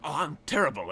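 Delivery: lightly put-on grinning shopkeeper; smiling tailor. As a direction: beam through the words as the tailor. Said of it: "he smiles really".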